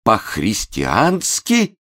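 very slow, high, rising question; bewildered. A narrator's reading. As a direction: stretch "По-христиански" into lost disbelief. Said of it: "растерянно пролепетал". (растерянно, протяжно переспрашивает)

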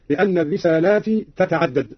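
normal talking voice

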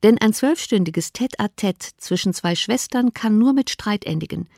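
ordinary delivery

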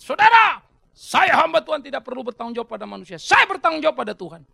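loud, high protesting declaration; mock a pastor loudly dodging accountability; evasive pastor. Preacher mimicking a pastor dodging accountability, loud and evasive. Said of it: "pendeta berkelit".

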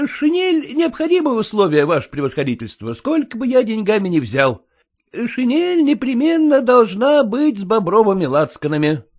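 haggling, mildly insistent; a hint of a wheedling clerk; lightly voiced pleading petitioner. A narrator's reading. Kutsin bargaining with the general, mildly pleading.

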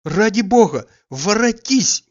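loud, high, crying plea; tearful cry. Acted. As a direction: cry it out through tears, begging her back. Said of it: "не голосом, а слезами кричал он".

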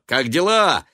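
high, loud, jubilant cry; yelling. A narrator's reading. Son greeting his mother, yelling happily. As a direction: yell it before anyone can answer. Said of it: "завопил он".

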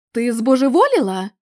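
гучніше, з обуреним натиском; обурено накинься на неї. Acted. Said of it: "обурився Ларі". (обурений вигук, гучніший)

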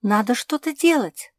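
high, loud alarm; alarmed gasp. A narrator's reading. Pug urging action to save the cat, gasping alarm. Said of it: "ахнула Жози".

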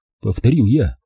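plain reading voice

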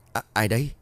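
halting, nervous question; a touch of fearful stutter; stammered question. A narrator's reading. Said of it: "Chú Công lắp bắp hỏi".

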